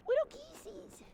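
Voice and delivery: cutesy coo to little birds. Real, not acted. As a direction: squeak it to the geese like they're toddlers. Cooing at geese.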